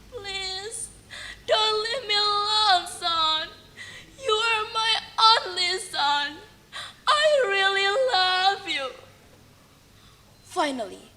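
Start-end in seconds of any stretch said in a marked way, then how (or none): 0.1-8.9 soft, higher, tearful pleading; sadly. Acted. Mother begging her only son not to leave, softly tearful. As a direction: hold him back with a quiet, tearful plea. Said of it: "His mother answered sadly".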